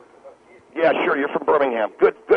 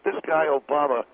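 ordinary talk, nothing marked

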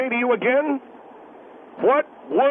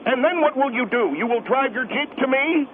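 ordinary talk

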